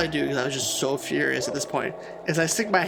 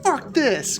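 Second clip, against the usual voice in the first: put-on teen anger; 8 semitones higher than usual. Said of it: "'cause I was all angry".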